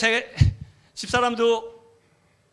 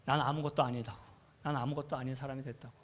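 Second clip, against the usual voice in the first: despairing mutter; 6 semitones lower, 10 dB quieter, faster than usual. Retold or imitated. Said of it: "막 달리기 시작했어요".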